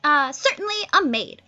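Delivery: put-on high, girlish Miranda, pitched well up; Miranda's voice. Miranda answers Ferdinand, high and girlish. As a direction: play the wide-eyed young Miranda. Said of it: "Miranda's like".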